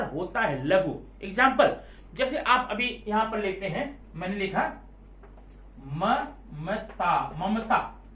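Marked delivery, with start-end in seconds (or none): 5.9-7.3 very slow, syllable by syllable, falling at the end; drawn out slowly. Shown as a demonstration. Teacher stretching Mamata to count its syllables. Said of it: "अब इसको आप कैसे पढ़ते हैं?".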